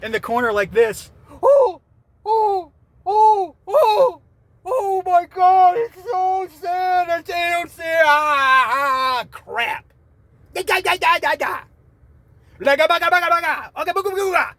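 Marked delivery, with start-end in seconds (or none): 1.4-9.9 high, loud, slow hammed-up wailing; mock sobbing reactor. Imitating a weepy reaction channel with hammed-up high sobs. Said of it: "People sitting there, reactions like". 10.5-14.5 high, loud nonsense outburst; gibberish babble. Real, not acted. Ranting fan bursting into high loud gibberish. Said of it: "My brain just went nuts there".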